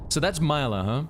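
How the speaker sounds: ordinary delivery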